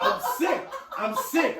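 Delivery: high, breathy laugh through the words; laughing disbelief. Real, not acted. Admitting his dream jealousy to his friend, laughing. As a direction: laugh helplessly at yourself.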